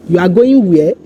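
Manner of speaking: WHERE lifted at the end; statement order asked by raising "where"; question intonation. Shown as a demonstration. Teacher showing the most casual way to ask, question intonation. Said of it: "raise the, your voice pitch at the end".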